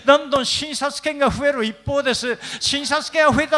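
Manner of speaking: put-on high-pitched old person's lament; elderly complainer. Old person complains to pastor of failing body, high lament.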